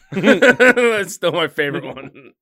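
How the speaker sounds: loud, high, slow through laughter; laughing. Real, not acted. Friend recalling a favourite running joke, laughing hard. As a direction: push the words out while cracking up.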